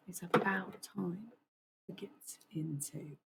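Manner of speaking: British nature narrator, trailing off very quiet; British accent. Real, not acted. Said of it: "I started speaking in a British accent".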